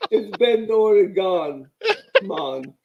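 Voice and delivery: mock Jamaican accent. Still doing the joke Oregon accent, mock Jamaican accent. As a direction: wrap the bit in a fake Jamaican accent. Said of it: "It's also Jamaican".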